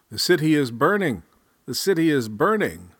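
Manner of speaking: loud, urgent alarm call; alarmed shout. Acted. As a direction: shout to wake the house. Calls parents to wake up.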